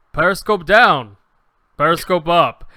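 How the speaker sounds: loud, deliberate submarine voice prompt; big voice-actor delivery, each command separate; voiceover announcer. Retold or imitated. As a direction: boom it out like a recorded command. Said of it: "he does voiceovers for a bunch of things".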